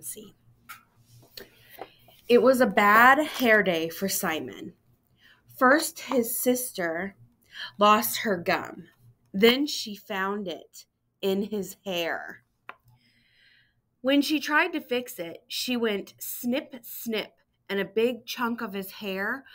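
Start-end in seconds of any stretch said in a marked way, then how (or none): 2.3-4.7 bright, even read-aloud voice; storybook narration. A narrator's reading. Teacher reading a picture book to students, storybook voice. 15.5-17.3 "snip" said slow, rising then fading; snip snip effect. A narrator's reading. Narrating the sister's haircut, slow playful snips.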